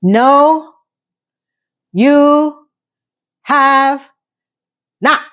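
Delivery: slow, word-by-word emphatic no; angry. Real, not acted. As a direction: spit out each word separately. Furious rebuttal of Carrie's line.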